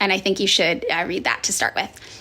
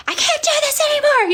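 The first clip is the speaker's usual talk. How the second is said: much higher, strained voice at the end of her rope; exasperated outburst. Retold or imitated. Fed-up daughter to screaming mother, high exasperated cry. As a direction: push the pitch way up like you're snapping.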